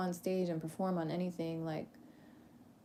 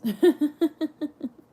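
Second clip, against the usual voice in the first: high, loud run of laughter; amused laugh; 8 semitones higher, 12 dB louder than usual. Real, not acted. Repeating her joke about tears, amused laugh. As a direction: crack up at your own joke.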